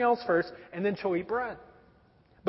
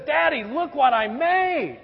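put-on excited child; 7 semitones higher, 5 dB louder, slower than usual. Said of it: "and she says".

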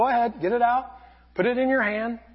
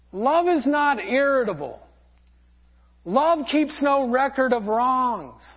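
ordinary talk

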